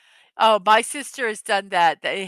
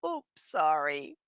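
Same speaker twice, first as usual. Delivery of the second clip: small, soft, slower sheepish oops; quietly embarrassed aside; sheepish apology; 5 semitones lower, 8 dB quieter than usual. Retold or imitated. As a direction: mumble it like you hope nobody noticed. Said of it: "you're like".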